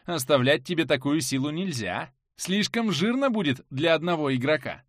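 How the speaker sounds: usual speaking voice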